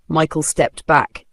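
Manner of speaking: plain reading voice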